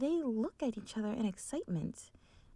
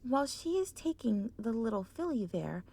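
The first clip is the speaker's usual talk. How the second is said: ordinary delivery, nothing marked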